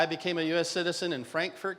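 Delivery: normal talking voice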